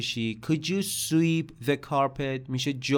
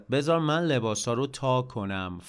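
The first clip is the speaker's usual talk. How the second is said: usual speaking voice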